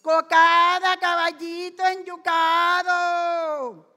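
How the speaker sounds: loud, drawn-out hawker's call; sing-out seller's shout, long final syllables; street vendor's cry. Acted. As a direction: cry your wares down the street, stretching the vowels. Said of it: "yo iba gritando".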